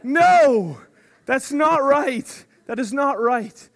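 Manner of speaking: imitating himself yelling at a banana eater; mock outraged shout. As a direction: cry out in joking horror at the brown banana. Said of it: "I'm like".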